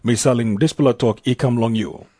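ordinary delivery

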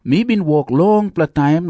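ordinary talk, nothing marked

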